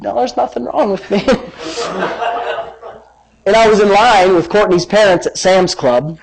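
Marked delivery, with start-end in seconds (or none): 0.0-2.3 laughing denial. Retold or imitated. Laughing at his own retold denial.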